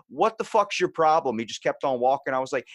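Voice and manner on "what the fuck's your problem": angry challenge. Retold or imitated.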